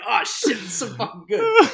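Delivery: high, loud gushing over the scene; raving excitedly to friends. Real, not acted. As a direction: burst out with delight.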